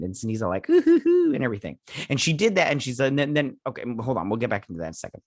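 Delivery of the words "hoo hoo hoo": high, loud imitated "hoo, hoo, hoo"; imitated villain's high hooting; mock hooting. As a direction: hoot it out in a pitched-up voice. Imitating Cindy's gleeful reaction.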